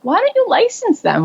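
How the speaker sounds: high, eager push; excited advice from a friend; urging. Retold or imitated. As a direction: sell her on the idea. Someone urging her to license.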